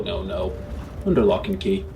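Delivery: ordinary talk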